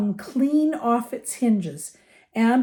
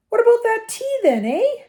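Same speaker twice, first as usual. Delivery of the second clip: very high, loud, hearty query; Hagrid; 7 dB louder than usual. A narrator's reading. Giant rubs his hands and asks for tea, hearty. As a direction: sing out the request as a jolly giant.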